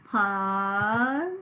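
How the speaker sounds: "pause" sounded out as a long syllable; drawn-out syllable. Shown as a demonstration. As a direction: stretch "pause" through its final hiss. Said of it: "first syllable".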